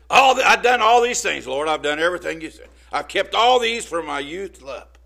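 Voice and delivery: put-on boastful young man. Young man boasting to Jesus, loud and proud. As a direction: brag like a proud youth.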